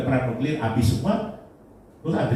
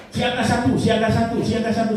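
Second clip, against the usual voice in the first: high, urgent mock yell; imitated alarm shout; 9 semitones higher, 4 dB louder than usual. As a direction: mimic someone calling out an alert, high and repeated. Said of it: "orang berteriak". (menirukan teriakan siaga bernada tinggi)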